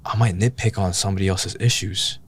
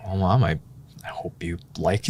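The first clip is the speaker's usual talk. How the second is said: whispered through tears with a small laugh; barely voiced, choked up and laughing softly; emotional hushed call to mom; tearful whisper; 4 dB quieter than usual. Telling mom while crying, emotional call.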